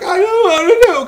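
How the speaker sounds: loud high-pitched hysterical wail, imitated; exaggerated freaked-out squeal; mock panic.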